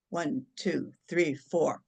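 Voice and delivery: low, even count with spaces between numbers; measured counting. Shown as a demonstration. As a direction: count them calmly through the breath. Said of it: "and I'll go".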